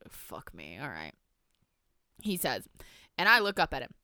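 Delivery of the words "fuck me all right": very low, whispered curse; hushed, self-directed grumble; muttered aside. Real, not acted. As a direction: swear under your breath. Muttered aside at own accent.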